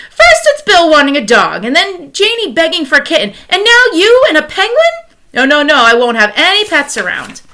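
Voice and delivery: high, loud, fed-up wife refusing; exasperated scolding. Acted. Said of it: "said Missus Popper".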